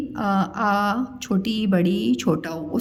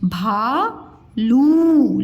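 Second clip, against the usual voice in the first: भालू drawn out very slowly, भा and लू apart; syllable by syllable; much slower than usual. Shown as a demonstration. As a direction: sound out भालू with both vowels held long. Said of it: "उच्चारण ऐसे में करके बताया करूँ". (भालू को अलग-अलग, बहुत धीरे)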